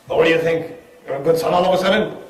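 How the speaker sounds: mock a parent's suspicious retort; a hint of incredulous parental sarcasm; sceptical parent.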